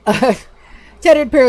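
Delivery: laughing, rushed. Real, not acted. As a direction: giggle through it fast. Repeats line after laughing fit.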